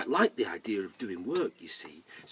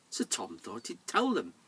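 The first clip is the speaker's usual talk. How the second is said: usual speaking voice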